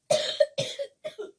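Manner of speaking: big choking cough; hammed-up coughing fit; loud hacking cough. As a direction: cough hard on the smoke. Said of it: "with a terrible cough".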